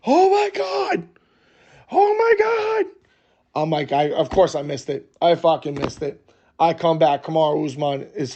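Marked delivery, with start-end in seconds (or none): 0.1-2.9 very high, drawn-out mock scream; imitated crowd scream. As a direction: imitate a room screaming in shock, high and stretched out. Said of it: "everybody starts screaming".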